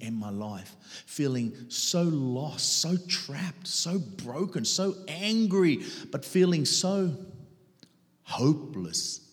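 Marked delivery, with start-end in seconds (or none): none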